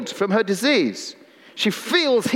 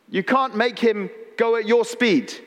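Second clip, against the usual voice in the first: ordinary talk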